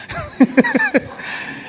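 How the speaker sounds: a sudden high laugh that breaks off the story; burst of laughter. Real, not acted. Preacher laughing at his own story, high laughter.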